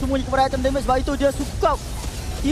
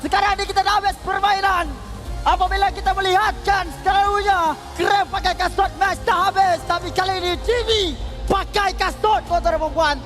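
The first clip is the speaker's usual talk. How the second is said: high, hyped caster talk; excited; 8 semitones higher than usual. Real, not acted. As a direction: ride the win with energy. Co-caster hyped after win.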